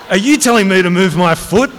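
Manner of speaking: high, loud, indignant challenge; outraged question. Acted. Colonel challenged by his major over a foot, outraged. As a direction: dare the subordinate to say yes.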